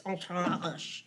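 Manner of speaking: slow, drawn-out French Entourage; really French accent. Retold or imitated. Imitating him correcting Joe, really French accent. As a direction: stretch the word in a heavy French accent. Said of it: "He wouldn't be like".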